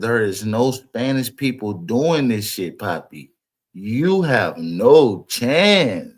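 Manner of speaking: low, slow quote of a father's verdict; deliberate restatement. Retold or imitated. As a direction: drop low and stretch the last words out for weight.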